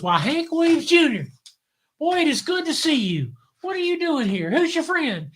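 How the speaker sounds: high-pitched, delighted greeting. Retold or imitated. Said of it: "[name] comes to the door, and he goes".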